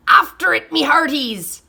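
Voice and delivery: high, loud order; captain's command; pirate command. A narrator's reading. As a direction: command the crew to chase it. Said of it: "Braidbeard commanded".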